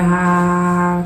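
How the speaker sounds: flat-pitched, drawn-out mock "ha"; mocking laugh. Woman mocking the girls stuck arguing with her, fake laugh. Retold taunting in an argument.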